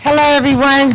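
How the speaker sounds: ordinary talk, nothing marked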